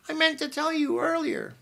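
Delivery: an excited aunt bursting into the room; Aunt Matilda. A narrator's reading. Aunt Matilda bursting in on the boys, high and bustling.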